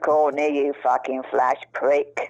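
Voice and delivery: a hint of a gruff slow jibe; lightly voiced hard man. Retelling Charlie insulting Courtney, lightly voiced low mock jibe.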